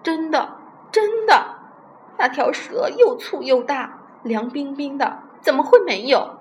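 high, loud, insistent protest; frightened crying out. A narrator's reading. Old Chen swearing the snake is real, alarmed cries. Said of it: "他还叫着".

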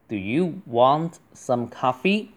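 normal talking voice

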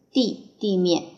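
ordinary talk, nothing marked

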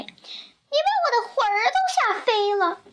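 put-on high, fast, startled old woman; acted scared squeal at a rapid pace; frightened old woman's cry. A narrator's reading. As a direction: blurt it out high and panicky. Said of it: "瘦小的老妇人喊起来".

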